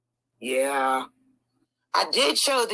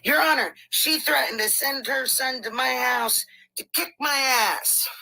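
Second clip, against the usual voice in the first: whiny mock plea; 6 semitones higher than usual. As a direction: ham up his pleading to the judge. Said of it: "starts with".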